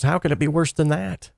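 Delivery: can't believe it could get worse; a friend's alarmed, rising question; incredulous alarm. Retold or imitated. Friend alarmed by bad news.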